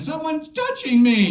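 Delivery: put-on high, thrilled squeak of a teen boy; giddy high voice. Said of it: "You're like".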